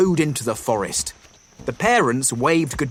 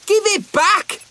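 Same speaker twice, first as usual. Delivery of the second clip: put-on high, loud childish yell; shouting weasel kid; 5 dB louder than usual. A narrator's reading. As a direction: squeal it like a squabbling little brother. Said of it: "one of the brothers shouted".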